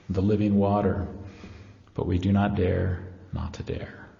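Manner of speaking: ordinary talk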